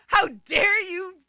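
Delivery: high, slow, loud whiny complaint; mock outrage. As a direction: play her indignant, dragging it out. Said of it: "she got mad at me".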